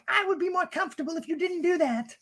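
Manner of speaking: put-on high voice, held up level; imitating her. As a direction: quote her in a high, thin-lifted voice. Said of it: "And she's like".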